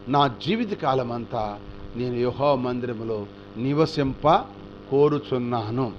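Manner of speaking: slow, low scripture reading; unhurried scriptural intonation; solemn verse recital. Acted. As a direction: intone David's vow reverently. Quoting David's vow from scripture.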